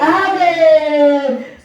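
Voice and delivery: loud, long-held amen; fervent amen. Real, not acted. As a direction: belt it out slowly to rouse the congregation. Preacher answers the congregation's amen.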